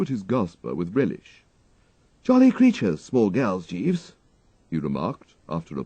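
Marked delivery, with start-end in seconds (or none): 2.2-4.1 higher, rueful upper-class chap; brightly ironic about the girls; weary young toff. A narrator's reading. Wooster remarks wearily to his valet about schoolgirls, rueful toff.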